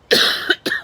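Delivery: sharp throat-clearing cough; a sudden tickle cough; real cough. Real, not acted. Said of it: "I'm sorry, guys, got a tickle".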